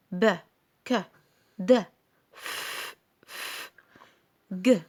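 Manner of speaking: ب، ك، د، ف as short isolated phonic sounds; clipped consonant sounds, not letter names, a pause after each. Shown as a demonstration. Teacher demonstrating phonics to children, isolated letter sounds. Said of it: "لو هقول صوته".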